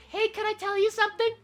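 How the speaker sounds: high singsong tease; mock teasing friend. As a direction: bait them with a secret. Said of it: "it's just like".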